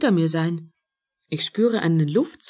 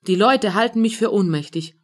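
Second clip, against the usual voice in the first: usual speaking voice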